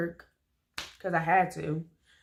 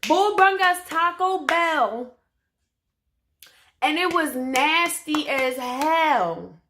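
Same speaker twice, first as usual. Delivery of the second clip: higher, louder, slow indignant rant; 7 semitones higher, 7 dB louder, slower than usual. Real, not acted. Ranting to viewers about the nasty Taco Bell, loud indignation. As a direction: spit it out in disgust. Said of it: "I was so pissed".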